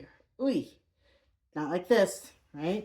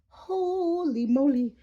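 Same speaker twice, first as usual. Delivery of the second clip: slow, high, drawn-out holy moly; amazed; 8 semitones higher, 5 dB louder, much slower than usual. Real, not acted. Teacher marvels at her toes, slow and high. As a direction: stretch it out in amazement.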